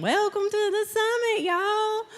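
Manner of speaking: playful Southern accent greeting. Shown as a demonstration. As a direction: let the Southern accent slip out on purpose. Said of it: "brings out my inner Southern accent".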